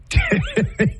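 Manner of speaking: quick amused laugh; a burst of laughter at herself; short laugh. Real, not acted. Speaker laughing at own comeback to her mom, short laugh.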